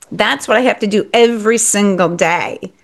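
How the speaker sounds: ordinary delivery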